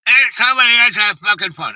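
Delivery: imitated mom's loud, high holler; acted-out irritated shout; yelling across the room. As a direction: holler crossly about the phone. Mom yelling about ringing phone.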